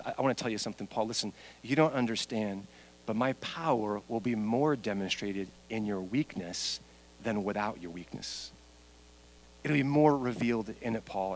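low, soft, intimate counsel; gentle confiding. Acted. Preacher voicing God explaining to Paul, gentle and hushed. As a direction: confide it quietly, well below full voice.

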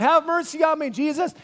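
high, loud pleading yell. Acted. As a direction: yell for mercy from the roadside. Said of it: "yelling out".

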